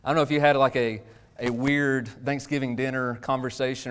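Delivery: usual speaking voice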